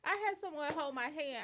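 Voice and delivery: usual speaking voice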